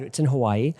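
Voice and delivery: ordinary delivery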